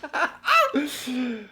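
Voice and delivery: high-pitched madman's laugh; hammed-up crazy cackle, pitched high; mad cackle. Mad Irishman laughing after his reply, high hammed-up cackle.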